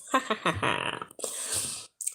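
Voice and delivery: breathy, trailing laugh; breathy giggle. Real, not acted. Vlogger laughing at her own silly greeting, breathy giggle. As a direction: giggle until the breath runs out.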